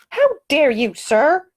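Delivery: sharp, high indignant challenge. Acted. Woman rebukes the snoring gentleman, indignant. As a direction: scold him with outrage. Heroine confronts rude passenger.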